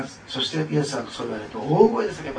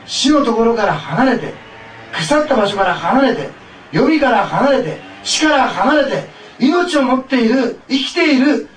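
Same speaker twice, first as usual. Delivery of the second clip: preaching at full force; 11 semitones higher, 11 dB louder than usual. Acted. Pastor calls the congregation to rise, fervent loud preaching. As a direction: build to a roaring altar call.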